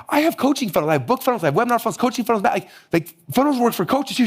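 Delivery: pitched high, rattling off funnels; excited listing. Retold or imitated. Pitching funnels to a coach in the lobby, high and excited. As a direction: eagerly sell her on it.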